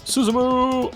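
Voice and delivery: high, loud yell across a crowd; shouting. Acted. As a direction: bellow the name at full lungs. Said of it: "shouted at the top of his lungs".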